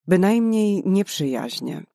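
ordinary delivery

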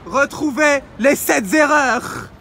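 lightly mock Quebecois accent, slowing down. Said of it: "Je parle avec un accent québécois".

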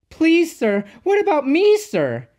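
very high, loud pleading; anxious. A narrator's reading. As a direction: plead eagerly in a little engine's squeal. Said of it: "asked Peter Sam anxiously".

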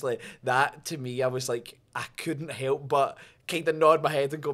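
usual speaking voice

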